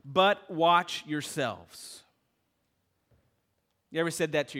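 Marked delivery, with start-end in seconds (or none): 0.0-2.0 slow, weighty reading of the verse; slow scripture reading. Acted. Pastor reads the verse slowly to the church. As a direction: read it slow and heavy.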